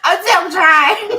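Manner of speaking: real laughter; laughing. Real, not acted. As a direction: try to talk but the laugh wins. Can't stop laughing.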